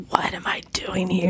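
breathy aside, barely voiced; whispered thought. Voicing newcomer's inner thought.

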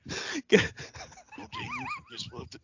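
soft, breathy Jake line; whisper-soft Jake the Snake; Jake Roberts impression. Shown as a demonstration. Quoting Jake to Alex Trebek about Damien, hushed. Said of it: "and then he says".

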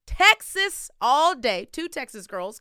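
loud, high, pumped-up announcer welcome; big podcast-host shout-out; hype host intro. Acted. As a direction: bring in the guest like a hype MC. Hyped show intro over music.

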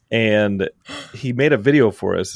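normal talking voice

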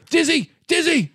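high, insistent call of the name. Acted. Flustered Komodo hollering for Dizzy, calling out. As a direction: call out high, pitch dropping on each name.

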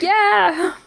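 high, drawn-out celebratory whoop; excited cheer. Acted. Co-host cheering on behalf of the represented viewer, excited cheer. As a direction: whoop along like a thrilled fan. Continues the acted fan cheer.

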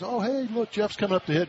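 bright, high call to look at the screen; cheerful friend. Retold or imitated. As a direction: point it out with delight. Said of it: "Kara Berkman says".

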